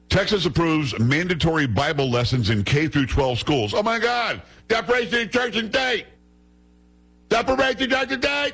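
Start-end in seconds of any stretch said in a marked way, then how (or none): 3.7-8.5 high-pitched caricature of hysterical protesters; hammed-up shrieking in mock outrage; mock outraged yelling. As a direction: screech it in a silly panicked voice. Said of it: "That's what they're yelling".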